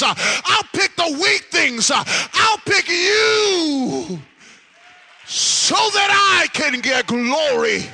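high, loud, gasping sermon cadence; full-force preaching. Real, not acted. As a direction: shout it out in driving, punched phrases.

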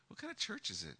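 high, soft imitation of a lady's question; imitated lady. Lady asking about his church, high soft imitated voice. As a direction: quote her softly, pitched well up.

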